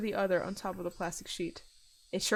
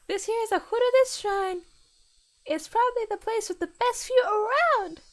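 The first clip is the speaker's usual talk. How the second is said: put-on bright girlish tone; sweet high schoolgirl; 12 semitones higher, 4 dB louder than usual. Girl voice retake after laugh.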